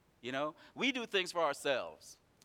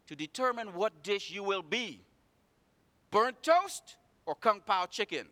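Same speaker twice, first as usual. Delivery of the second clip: rising preaching; 8 semitones higher, 7 dB louder than usual. Acted. Preacher lifts to full force.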